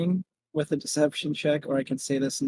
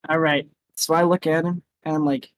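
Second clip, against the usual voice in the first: normal talking voice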